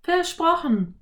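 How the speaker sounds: put-on high girl voice, smiling; a smiling little girl answering; Anne, smiling. A narrator's reading. Anne promises. (Mädchenstimme, lächelnd)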